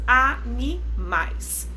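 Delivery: animais drawn out slowly as a-ni-mais; slow syllable by syllable. Shown as a demonstration. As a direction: spell out animais in slow beats. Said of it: "Trissílaba". (animais bem devagar, sílaba por sílaba)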